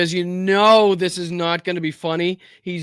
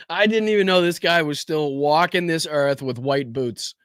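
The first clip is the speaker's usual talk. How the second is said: usual speaking voice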